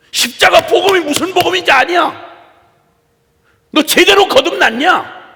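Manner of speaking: loud, high pulpit shout; impassioned, shouted exhortation; full-force preaching. Real, not acted. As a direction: thunder a challenge at the congregation.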